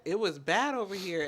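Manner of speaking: usual speaking voice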